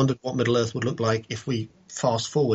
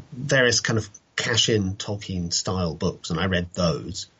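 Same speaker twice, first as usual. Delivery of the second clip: ordinary talk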